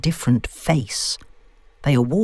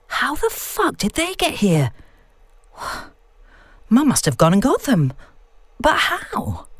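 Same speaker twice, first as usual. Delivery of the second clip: stunned disbelief; 9 semitones higher than usual. Acted. Shocked at stones appearing.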